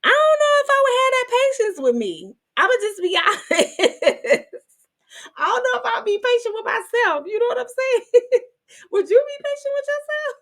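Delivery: giggly squeals of amazed gratitude; laughing disbelief. Real, not acted. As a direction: marvel at God's patience, half laughing. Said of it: "And I'm like".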